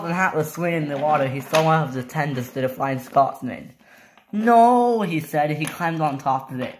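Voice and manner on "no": high, loud acted "no"; dismayed cry. Topham Hatt finding the tender, loud dismayed cry. Sees Flying Scotsman's tender.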